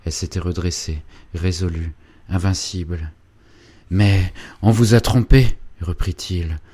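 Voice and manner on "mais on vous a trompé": put-on ardent young man, louder, pressing hard; urgent lover. Félicien urging Angélique to leave her dreams, loud and fervent. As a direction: urge her to come away with you.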